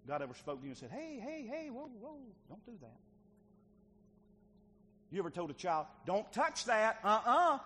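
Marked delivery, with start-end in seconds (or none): 0.9-3.0 high sing-song heys dropping to soft; mock gentle warning. God warning the listener, high cautioning heys. 6.0-7.6 loud, high warning; acted parental scolding. Parent telling a child not to touch, loud scolding.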